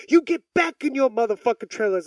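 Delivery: mock angry yell. Retold parent yelling at offender.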